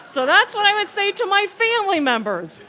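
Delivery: loud, high-pitched punchline delivery; calling over laughter. Real, not acted. Speaker tops the audience's laughter with a joke's moral, calling out.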